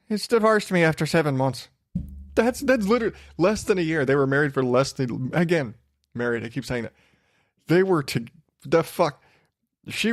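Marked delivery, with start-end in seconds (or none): 0.1-4.0 quick, put-on Irish voice; mock Irish accent. Podcaster mimicking the bride announcing her divorce, quick mock Irish accent. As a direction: rattle it off in a hazy fake Irish accent. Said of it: "'cause she's Irish".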